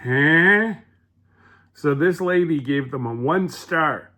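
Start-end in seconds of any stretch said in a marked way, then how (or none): 0.0-0.8 loud, higher, rising "huh?"; baffled, re-enacted double take; puzzled reaction. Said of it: "that we went".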